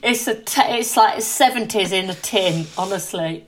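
laughing after a sip of soda; while sipping a drink. Real, not acted. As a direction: talk with a drink at your lips. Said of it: "take a sip of my cream soda".

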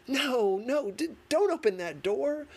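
high, loud alarmed plea; yelling a warning at the hero; bursting out. A narrator's reading. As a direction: blurt it at the screen like a panicked viewer. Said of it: "bursting out".

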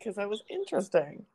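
normal talking voice

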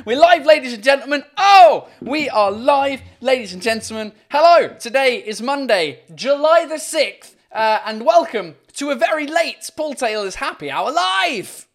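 loud, high, hyped live-show opening; show announcer. Real, not acted. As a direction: belt out the welcome to the crowd.

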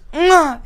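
mock aggressive throat clear; imitated grunt. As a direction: hock up a wind-up grunt before a punch. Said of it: "makes that noise".

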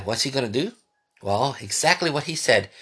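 ordinary talk, nothing marked

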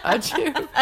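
real sneeze with laugh; sneezing. Real, not acted. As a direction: let out a sneeze. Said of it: "I'm gonna sneeze again".

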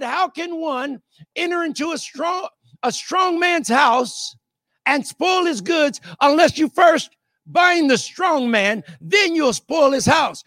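rising, driving preacher's cry; fiery pulpit delivery; preaching at full force. Acted. Preacher quoting Jesus at full force.

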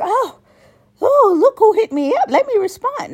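falsetto, very high mock excitement; mocking eager voice. Mocking people who reply eagerly, shrill high mock gushing. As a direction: squeal it like a giddy fan. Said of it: "be like".

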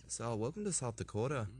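put-on South Dakota accent. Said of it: "South Dakota accent?".

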